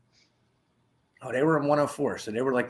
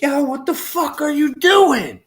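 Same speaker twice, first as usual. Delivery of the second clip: much higher, louder, drawn-out yell; retold yell; 9 dB louder than usual. Inmates yelling at the man showering in his jumper, slow loud yell. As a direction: bellow it slowly across the tier.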